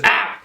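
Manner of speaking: loud short cry after a bump; yelp of pain. Acted. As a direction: yelp as if you hit your head. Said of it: "hits his head on the trunk".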